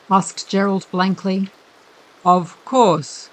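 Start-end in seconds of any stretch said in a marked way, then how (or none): 2.2-3.3 a lightly voiced stuffy-nosed gentleman, slow; odd R's and blocked-nose M's, unhurried; curious accent, head cold. A narrator's reading. Creepy gentleman admires the unconscious bailiff, lightly stuffy and slow. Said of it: "He spoke with a curious accent".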